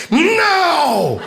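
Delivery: high, loud yelp of refusal; bratty no. Retold or imitated. Grown daughter refusing boss, high childish yelled no. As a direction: shriek it back like a kid.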